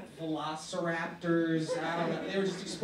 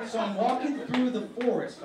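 ordinary talk

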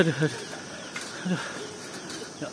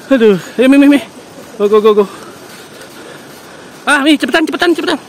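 high, loud panicked yelling; frightened shouting; 9 semitones higher, 5 dB louder, faster than usual. Real, not acted. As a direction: yell in real panic. Crossing a shaky bridge, urging companion.

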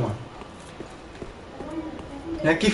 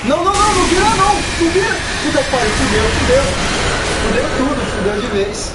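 frantic high yelling, panicked; panicking gamer; 5 semitones higher, 5 dB louder, faster than usual. Real, not acted. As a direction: shout in panic as it goes wrong. Gamer panics as enemy attacks.

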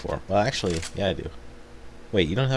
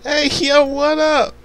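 high, loud hype greeting; hype man voice; 7 dB louder, much slower than usual. Acted.

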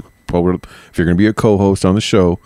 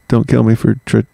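ordinary talk, nothing marked

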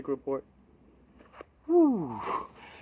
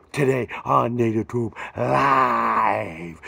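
show intro; 5 dB louder, much slower than usual. Acted.

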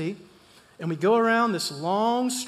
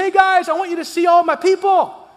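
much higher and louder, bursting with welcome; overjoyed Filipino pastor welcoming guests; joyful greeting; 11 semitones higher, 12 dB louder than usual. Retold or imitated. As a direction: call out like a delighted host. Voices joyful pastor greeting them.

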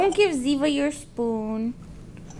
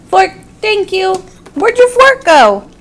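loud exclaim; 6 semitones higher, 11 dB louder than usual. Real, not acted. Baby threw fork.

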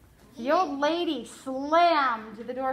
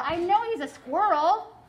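put-on higher, louder exasperated girl; 6 semitones higher, 7 dB louder than usual. Flora snapping at the doctor, exasperated and louder. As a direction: blurt it out, fed up.

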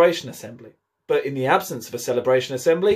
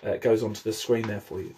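usual speaking voice

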